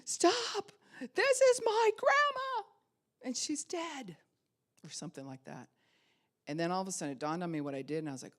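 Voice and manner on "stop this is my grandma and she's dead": put-on high, loud shout of a grieving child; shrill kid yelling stop at the wake; child's outburst. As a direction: scream it at the adults. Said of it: "I turn to everybody and go".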